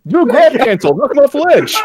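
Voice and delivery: very high cartoon radio yell; Star Fox pilot voice. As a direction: shout the classic barrel roll line. Star Fox quotes, hammed up.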